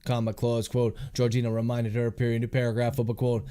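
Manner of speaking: measured dictation, calling out punctuation; dictating. Real, not acted. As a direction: read the draft aloud with spoken punctuation. Author dictating his novel aloud.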